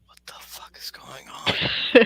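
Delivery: slow, bewildered whisper; stunned whisper. Acted. Game master voices onlookers staring at the hanging player, stunned whisper. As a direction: whisper it slowly in disbelief. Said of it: "and they're like".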